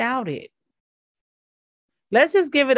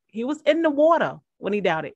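normal talking voice

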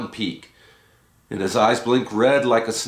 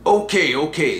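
giving in; 5 semitones higher than usual. A narrator's reading. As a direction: hurry to calm him. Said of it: "says Boba Fats".

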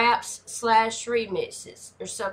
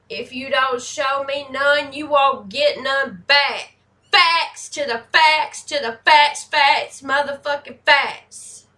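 loud, high, fired-up endorsement; hollering in hearty agreement; emphatic agreement; 5 semitones higher, 8 dB louder than usual. Real, not acted. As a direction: drive each phrase home at full voice. Emphatic agreement with rap lyrics.

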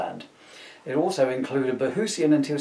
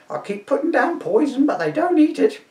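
put-on high shrieking old woman; an indignant old dear shooing a Viking; old lady to constable, high and indignant; crone voice; 12 semitones higher, 5 dB louder than usual. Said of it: "my crone voice".

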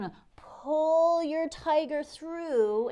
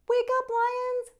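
much higher, louder wake-up call; cheerful call; 12 semitones higher, 5 dB louder than usual. Acted. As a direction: call the lions awake. Waking the sleeping lions.

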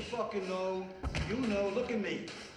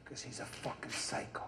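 urgent whisper; 7 semitones lower, 7 dB quieter than usual. Acted. Warning about the psycho.

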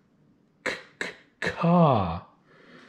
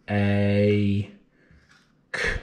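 ordinary delivery

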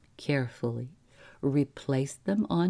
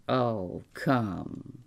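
brusque; 4 semitones lower than usual. A narrator's reading. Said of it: "said Mister Spelvin brusquely".

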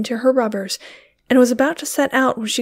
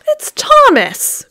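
much higher, louder, slow surprised cry; cried out; 8 dB louder, slower than usual. A narrator's reading. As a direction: recognise him with a shocked cry. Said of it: "cried Sarah Riding".

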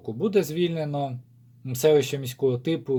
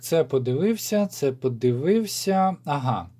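ordinary talk

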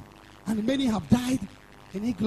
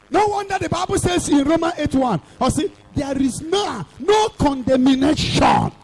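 raised, ringing proclamation; full-force preaching; 3 semitones higher, 4 dB louder than usual. Acted. Preacher declaring no condemnation to the congregation, forceful preaching. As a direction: preach it out, drawing out the key words.

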